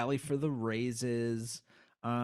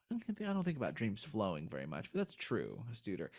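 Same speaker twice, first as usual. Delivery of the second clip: quiet; 4 semitones lower, 7 dB quieter, faster than usual. Real, not acted. Quiet aside.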